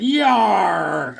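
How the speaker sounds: long, loud, high cartoon cry; mock battle cry. Showing off a Hawkman drawing, mock battle cry. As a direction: cry out like a comic-book hero.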